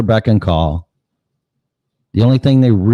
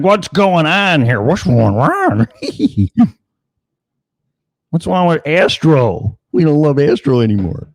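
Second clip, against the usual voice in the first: fussy high owner voice; 9 semitones higher than usual. Retold or imitated. Owner fussing over the dogs.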